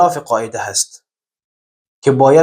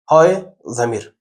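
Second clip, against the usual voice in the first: usual speaking voice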